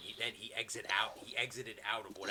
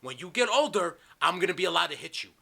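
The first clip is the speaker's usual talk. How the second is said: loud retold warning; re-enacted ultimatum, building higher and louder; letting him have it; 7 semitones higher, 11 dB louder than usual. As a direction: act out threatening a misbehaving kid. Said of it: "I just let him have it".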